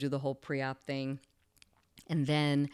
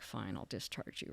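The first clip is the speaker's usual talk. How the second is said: low, soft, grudging concession; reluctant; 7 semitones lower, 13 dB quieter than usual. Retold or imitated. Surgeon losing argument with his patient, reluctant. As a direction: give in under your breath, reluctantly. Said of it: "like, reluctantly".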